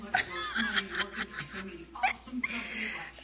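long quiet helpless laugh; soft breathy laugh. Real, not acted. Reacting to the egg joke, soft long laugh.

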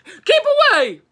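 high, very loud warning shout; full-voiced cry of alarm. A narrator's reading. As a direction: yell a sudden urgent warning. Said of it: "cried Gus".

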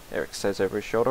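ordinary talk

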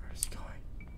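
hushed unvoiced whisper. Real, not acted. Gamer hiding from Grandpa, tense whisper. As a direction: breathe it so he can't hear.

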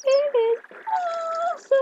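squeaky high voice crack. Real, not acted. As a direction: voice jumps suddenly very high. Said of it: "my voice just went unbelievably high pitch".

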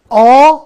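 a loud آ begun with a sharp catch in the throat; glottal stop demo. Shown as a demonstration. Teacher making students feel the glottal stop: loud آ. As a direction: hit آ hard from a closed throat. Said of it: "اون لرزش رو حس کن".

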